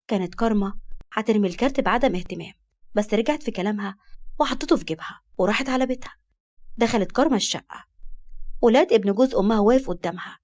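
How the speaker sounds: usual speaking voice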